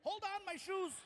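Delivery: high-pitched mock panic; comic, urgent objection; urgent protest. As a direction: call out high to stop everything. Acted protest at the weight reading.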